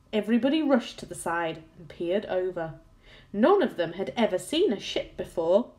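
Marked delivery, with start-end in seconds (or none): none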